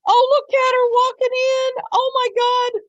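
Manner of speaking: high, loud surprised exclamation; excited gasp. Real, not acted. Livestream host sees defendant walk into court, surprised. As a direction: gasp and point her out.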